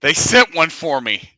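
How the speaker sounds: loud, high, stunned exclamation; shocked disbelief. Retold or imitated. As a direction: blurt it out in startled alarm. Panicked retold reaction.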